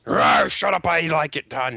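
hammed-up dragon; dragon roar. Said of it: "'Cause you're a dragon".

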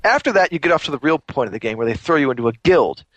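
normal talking voice

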